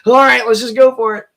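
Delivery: high, loud put-on voice; mock go-for-it call. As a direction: throw it out loudly as a mock quote. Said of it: "And I'm like".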